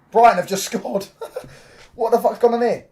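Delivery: high, loud burst with a laugh; shocked disbelief. Real, not acted. Streamer seeing Brighton score, high loud laughing disbelief. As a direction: cry out in amazed disbelief. Reacting to a sudden Brighton goal.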